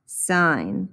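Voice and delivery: sine said like "sign"; careful sine, rhyming with line; sine, long i. Shown as a demonstration. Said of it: "This is pronounced sine".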